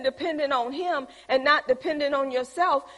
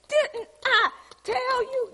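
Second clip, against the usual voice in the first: scolding; 10 semitones higher, slower than usual. Retold or imitated. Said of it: "grab 'em and say".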